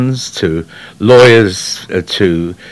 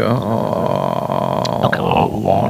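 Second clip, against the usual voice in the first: wet gargled voice; 3 semitones lower, 5 dB quieter than usual. Shown as a demonstration. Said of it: "what I call a wet voice".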